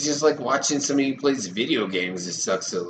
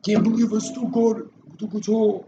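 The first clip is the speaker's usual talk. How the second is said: mock guitar hero; 11 semitones higher than usual. Mock rock star with guitar.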